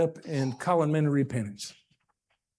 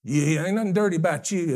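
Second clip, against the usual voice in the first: ordinary talk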